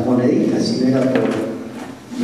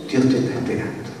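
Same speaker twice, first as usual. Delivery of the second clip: much softer, gentle promise; soft assurance; 2 semitones lower, 5 dB quieter, faster than usual. Real, not acted. Preacher gently promising forgiveness to the congregation, soft and quiet. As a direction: say it quietly, like a comfort.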